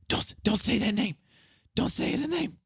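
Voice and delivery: high, urgent strained plea; acted desperate warning, pitched far up; frantic woman. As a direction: repeat it like a woman in a panic. Game master plays frantic woman grabbing player.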